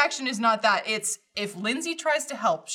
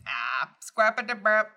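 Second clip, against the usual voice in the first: Sims character voice. Retold or imitated. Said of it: "She makes that noise and everything".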